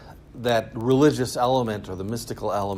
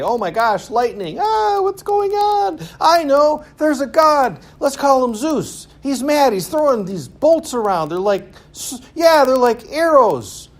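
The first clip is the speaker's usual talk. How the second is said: high-pitched, loud mock panic at lightning; hammed-up awestruck simpleton squeal; panicked primitive believers; 13 semitones higher, 6 dB louder than usual. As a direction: shriek theories like a frightened caveman. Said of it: "we don't need to say".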